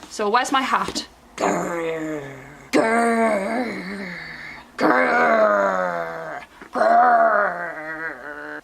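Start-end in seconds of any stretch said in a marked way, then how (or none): 1.4-8.6 low, rumbling growl; growling sound. Shown as a demonstration. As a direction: growl like an animal. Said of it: "Now we need to do a grrr, like a growling sound".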